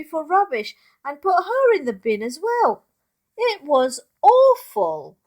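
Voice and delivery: dramatic dismay. A narrator's reading. Dramatic peak of bad dream.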